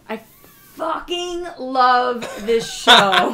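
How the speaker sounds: loud, drawn-out gushing with a laugh; real excitement. Real, not acted. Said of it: "how excited you get about it".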